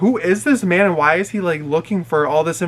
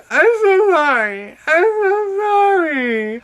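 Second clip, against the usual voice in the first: very high, slow wailed apology; mock crying; 13 semitones higher, 4 dB louder, slower than usual. Drunk girl apologises to her driver, mock crying. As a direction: stretch each apology into an exaggerated sob. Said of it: "She's on the phone crying".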